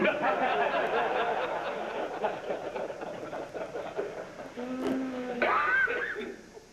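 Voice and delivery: long, high rising laugh at his own punchline; big laugh. Real, not acted. Storyteller laughing at his own football joke, big rising laugh. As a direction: let the laugh climb and roll on.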